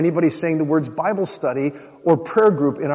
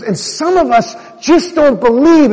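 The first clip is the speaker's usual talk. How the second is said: pointed remark; 10 semitones higher, 7 dB louder, slower than usual. Retold or imitated. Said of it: "she looked right at me".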